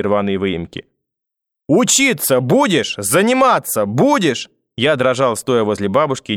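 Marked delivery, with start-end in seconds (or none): 1.7-4.5 high, slow, pounding each word; screaming, word by word. A narrator's reading. Grandmother stabbing scissors into desk, screaming word by word. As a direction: punch every word like a stab. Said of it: "кричала бабушка".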